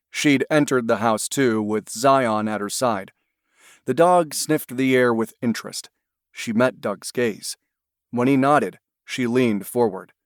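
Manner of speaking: ordinary delivery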